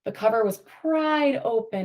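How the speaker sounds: normal talking voice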